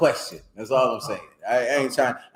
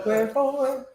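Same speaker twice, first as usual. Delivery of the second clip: WHEREFORE in a very high, softer pitch; overdone high question; 4 dB quieter, slower than usual. Shown as a demonstration. Teacher trying out the high note, overdone high WHEREFORE. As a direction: squeak WHEREFORE far up as a question. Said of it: "you ain't gotta do it all like that".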